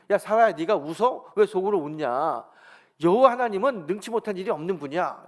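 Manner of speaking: loud mock rebuke; acted-out divine reprimand; retold scolding. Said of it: "꾸짖습니다".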